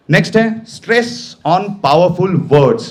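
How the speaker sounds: very slow, word by word; slow announcement. Shown as a demonstration. Trainer announcing the next point to students, very slowly. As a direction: spell out the heading for the class. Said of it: "announcing the point slowly".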